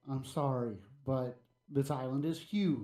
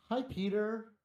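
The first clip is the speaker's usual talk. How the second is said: mild yell; 8 semitones higher than usual. Acted. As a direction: call out a greeting to someone at work. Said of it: "I yell".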